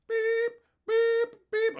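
high, loud beep imitation; mock trash compactor beeps; imitated beeping. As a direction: beep like a machine. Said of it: "it starts beeping".

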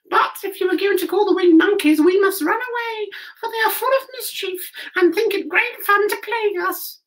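very high, squeaky little mouse voice; squeaky mouse queen. A narrator's reading. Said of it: "in her squeaky little voice".

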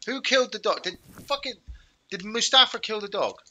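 loud, high indignant demand; outraged. Real, not acted. Player discovering his dog dead, shouting at teammates in outrage. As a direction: demand an answer, furious at losing the dog.

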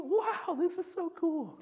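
lightly voiced childlike awe; wonder. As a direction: savor the amazement. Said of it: "I've become more".

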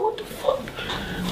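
soft, slow, voiceless disbelief; hushed, drawn-out shock; stunned retold reaction. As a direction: let it out slowly under the breath. Stunned after being shot.

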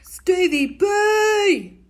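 long drawn-out call, high and loud; Australian-sounding drawn-out shout. Real, not acted. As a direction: call across the room, stretching the name out. Calling out to Stevie.

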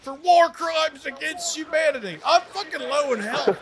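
high-pitched shouting game soldier; frantic soldier. Acted. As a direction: rail like a panicked trooper in battle.

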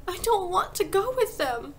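put-on high, slow child's protest; sudden shout. A narrator's reading. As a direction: refuse in a high, upset cry. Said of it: "Matilda shouted suddenly".

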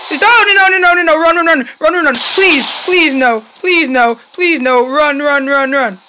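loud, high panicked chant; frantic pleading. Real, not acted. Player fleeing Grandpa, pleading in panic. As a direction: urge herself on in a panic.

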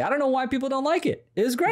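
high, fast sarcastic gushing; mock enthusiastic. After inventing a fake plot, sarcastic high gushing. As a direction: gush over it, clearly insincere.

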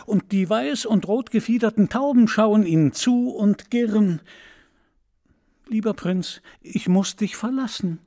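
the swallow tells of Egypt, longing and sad; eager swallow. Acted. Schwalbe spricht lange zum Prinzen. (Schwalbe, höher und lebhaft, wehmütig)

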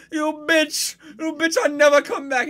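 put-on shouted high Indian-accented tantrum; hammed-up outrage in an Indian accent; spurned suitor's Indian-accented yell. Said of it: "she turns him down".

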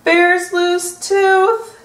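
high, loud, drawn out; excited finale. A narrator's reading. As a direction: stretch it out with delight. Story ending exclaimed.

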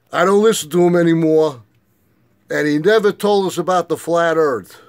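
1 loud rant. Retold or imitated. Retold rant at brother.

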